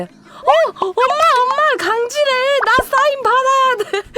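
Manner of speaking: put-on high, loud kid yelling for mom; imitating a kid who spots his idol, squealing high; excited child. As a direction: shout up to mom like a thrilled little kid. Child calling mom at signing.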